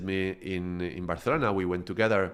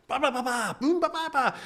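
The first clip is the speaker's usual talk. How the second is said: very high-pitched shouted babble; mock screaming players. Domino players yelling over their game, high mock screaming. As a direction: imitate noisy players slamming pieces down. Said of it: "screaming at each other".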